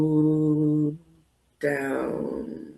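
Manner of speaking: low, drawn-out and slow; really slow. Shown as a demonstration. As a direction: stretch it out, very slow. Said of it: "Say it really slow".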